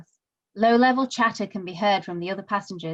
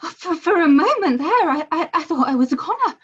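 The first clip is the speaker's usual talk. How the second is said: shaky relief; 6 semitones higher than usual. Acted. Relief after the scare.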